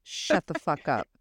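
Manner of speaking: mock snarky brush-off, half under breath; dismissive snark. Podcaster imitating her own rude humor, snarky. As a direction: imitate herself telling people off. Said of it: "I'm usually just the one that's like".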